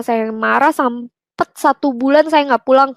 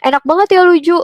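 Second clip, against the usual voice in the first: higher, louder mock-envious jab; teasing friend; 6 semitones higher, 5 dB louder, faster than usual. Office friend needling the speaker about faith, teasing. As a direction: imitate a friend's ribbing, pitched up. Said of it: "Dia sampai bilang".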